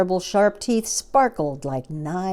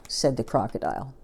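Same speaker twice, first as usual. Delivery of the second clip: ordinary delivery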